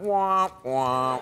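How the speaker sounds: low, drawn-out mock trombone; sad trombone wah. Host imitating the Debbie Downer sting, mournful mock trombone. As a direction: imitate a deflating trombone, stretched and dropping. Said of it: "I'm constantly going".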